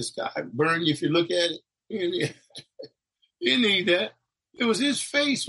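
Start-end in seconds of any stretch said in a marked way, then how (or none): 1.9-5.5 laughing. Real, not acted. Laughs while telling.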